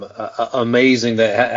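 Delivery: stumbling, stammering start. Real, not acted. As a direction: trip over the first words in a stammer. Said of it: "I'm sorry for stammering".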